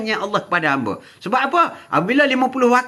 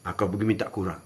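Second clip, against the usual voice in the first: low, soft urging voice; Musa's advice; 13 semitones lower, 8 dB quieter than usual. Acted. Musa urging Muhammad to ask Allah for fewer, low and soft. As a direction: nudge him quietly to go back and ask. Musa advises asking reduction.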